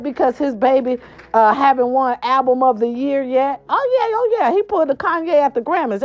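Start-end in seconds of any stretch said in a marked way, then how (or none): 3.7-6.0 high, quick excited exclaim. Real, not acted. Vlogger excitedly confirming [name] Grammys moment. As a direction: burst out with the juicy news.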